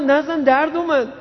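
put-on high, begging child's voice; pleading pupil. Pupil begging the teacher to stop hitting, high whine. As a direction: beg the teacher not to strike again.

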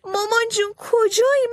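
put-on boy faking tears for the enemy; fake crying wail. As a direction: keep wailing like a lost child. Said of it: "بلند بلند ناله کرد و داد زد".

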